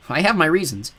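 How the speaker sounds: usual speaking voice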